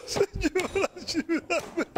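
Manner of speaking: high, hammed-up sobbing prayer; acted weeping plea. Preacher acts out Esau begging the Lord, mock sobbing. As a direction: wail it through tears, begging. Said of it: "aún con llanto".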